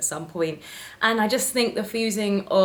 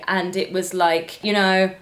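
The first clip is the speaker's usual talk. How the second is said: ordinary talk, nothing marked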